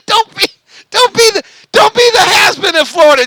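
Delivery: laughing high through the taunt. Real, not acted. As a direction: tease while still cracking up.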